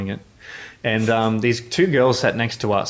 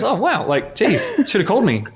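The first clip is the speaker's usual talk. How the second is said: higher, laughing and unbothered; nonplussed Nate; 11 semitones higher than usual. Retold or imitated. Nate shrugs off the break-in, high and laughing. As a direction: toss it off lightly, up high, through a laugh. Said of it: "nonplussed about it and goes".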